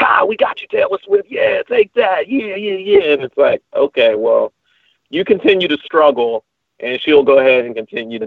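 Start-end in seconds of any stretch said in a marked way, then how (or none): 0.0-3.1 mock taunting. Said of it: "they're like".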